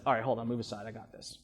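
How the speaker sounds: low, quiet, no-nonsense take-charge voice; the conscious mind taking over to inspect the offer; stern inner voice. Retold or imitated. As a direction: step in firmly and skeptically. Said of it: "the conscious mind steps up and says".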